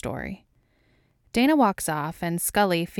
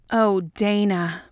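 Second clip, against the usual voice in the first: louder, drawn-out moan; mild yell; 2 semitones higher, 6 dB louder, much slower than usual. A narrator's reading. Mulder to Dana in bed, mildly yelling. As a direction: call the name slowly and loudly. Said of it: "Mulder yells".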